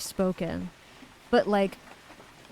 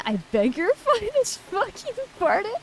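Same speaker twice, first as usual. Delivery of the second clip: high, shocked, half-laughing outrage; indignant disbelief; 11 semitones higher than usual. Real, not acted. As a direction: gasp it out like you can't believe it. Shocked reply to chat.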